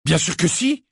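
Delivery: high, loud defiant outburst; stubborn defiance. A narrator's reading. Fred defies Sirius, loud and stubborn. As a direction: refuse to back down. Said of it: "avec une expression butée".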